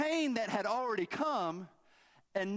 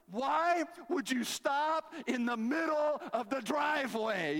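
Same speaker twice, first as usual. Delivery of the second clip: exasperated; 7 semitones higher, slower than usual. Retold or imitated. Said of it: "I was so angry".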